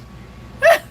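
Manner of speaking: sharp, loud, high laugh burst; high squeal of laughter. Real, not acted. Laughing at own giggles, loud high squeal. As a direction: let a shrieking laugh escape.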